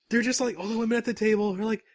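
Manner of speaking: ordinary talk, nothing marked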